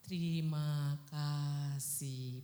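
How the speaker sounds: very slow, soft, dragged-out thanks; lazy slow-motion thank-you; mock sluggish drawl. Shown as a demonstration. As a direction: stretch every syllable like a slow-motion sloth. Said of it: "Bukan itu ya".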